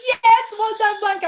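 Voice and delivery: high, loud happy praise; excited cheer. Real, not acted. Teacher praising Blanca, excited cheer. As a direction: cheer her on.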